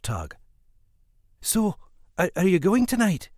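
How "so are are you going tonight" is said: high-pitched, eager question; enthusiastic. A narrator's reading. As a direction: lift the pitch with mild excitement. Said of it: "she whispered enthusiastically".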